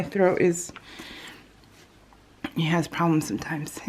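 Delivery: soft breathy whisper. Real, not acted. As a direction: whisper it, throat sore. Throat trouble, voice drops away.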